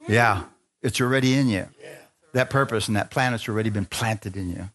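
normal talking voice

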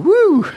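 long high "woo!", loud; tipsy whoop. Retold or imitated. Preacher recalling a spiked beer at a dance, giddy whoop. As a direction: whoop like the drink just hit you.

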